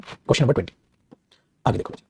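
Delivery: usual speaking voice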